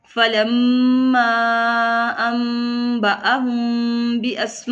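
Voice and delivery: slow, drawn-out Quranic chant with held vowels; Quran recitation. Shown as a demonstration. Teacher reciting the verse for students, slow tajweed chant. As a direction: recite it slowly like tilawat. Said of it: "teacher recites verse in tajweed".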